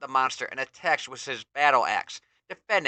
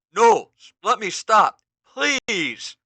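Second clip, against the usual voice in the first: much higher, slow desperate cry; Ram Man crying out; 8 semitones higher, 8 dB louder, much slower than usual. Acted. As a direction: cry out in pain. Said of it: "Ram Man cries out".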